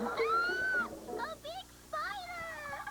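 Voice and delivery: startled by a big spider, cry out in fright; frightened gasp. Acted. Girl spots a spider, gasps.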